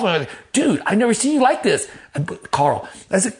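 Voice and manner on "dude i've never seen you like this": high-pitched, stunned reaction; startled protest. Retold or imitated. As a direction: act out being taken aback by his outburst. Stunned reply to yelling friend.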